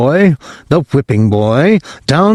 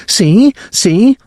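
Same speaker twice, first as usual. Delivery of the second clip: put-on very high, thrilled child's cry; excited little boy; 10 semitones higher than usual. Whipping boy waking his parents on Christmas morning, very high and excited. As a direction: wake them up bursting with joy. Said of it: "Whipping boy".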